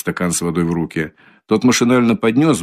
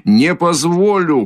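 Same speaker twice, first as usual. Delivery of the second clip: shouted again; 9 semitones higher, slower than usual. A narrator's reading. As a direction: bellow it out one more time. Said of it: "крикнул было другой раз Раскольников". (медленный громкий крик)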